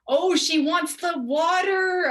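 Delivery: loud, high, slow taunt; mocking jeer. Imitating kids jeering at her word for water, loud mocking. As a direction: draw it out loud to make fun of her.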